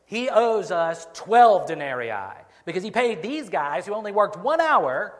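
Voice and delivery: loud, high protest, words leaned on; indignant grumbling. Acted. Preacher voicing vineyard workers grumbling about their pay, loud indignant protest. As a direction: complain loudly at the unfairness. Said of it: "Surely they must think something like this".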